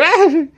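high mock cry mid-leap; short, high-pitched acted "ah"; leaping yell. Hades leaps through the wall of flames, mock yell.

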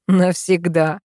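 one solemn mock-dramatic word; theatrical drama. A narrator's reading. Driver completing a joke pledge of devotion, theatrically dramatic. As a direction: pronounce it like a vow, playfully overdone. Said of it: "с театральным драматизмом произношу я".

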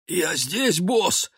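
high hoarse servant voice. Acted. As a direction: rasp it like someone with a cold. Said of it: "простуженным голосом".

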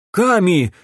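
high, loud, alarmed call; exclaimed name. A narrator's reading. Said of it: "воскликнул Доминик". (встревоженный возглас)

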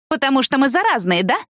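put-on a mild snide taunt at the old women; same spiteful tone. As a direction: echo the jab with venom. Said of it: "тем же тоном".

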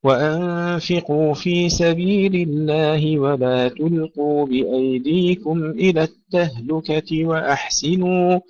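slow, melodic tajweed chanting with long held vowels; Quran recitation. Acted. Teacher reciting verses for pupils before explaining, measured tajweed recitation.

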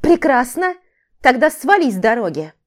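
sharp order to get out of the way; curt dismissal. A narrator's reading. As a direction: cut him off indignantly. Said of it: "Отрезала девушка".

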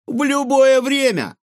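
high, loud challenging retort; defiant. A narrator's reading. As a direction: throw down the challenge. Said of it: "с вызовом заявил Егор".

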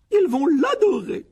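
very high, loud, slow gush; rapturous. A narrator's reading. Aunt delighted by her son's polite line, rapturous exclamation. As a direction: coo it out in doting delight. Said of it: "s'exclama la tante Pétunia avec ravissement".

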